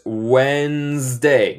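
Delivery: "Wednesday" said slowly as wenzday, no d; slow silent d. Shown as a demonstration. Teacher shows students how to say Wednesday. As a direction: drag out "Wednesday" without the d. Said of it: "No se dice Wednesday".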